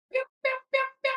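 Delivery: high squeaky pew pew gun noises; imitated shots. Telling a hunting buddy about the hunt, squeaky imitated shots.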